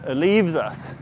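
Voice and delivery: ordinary delivery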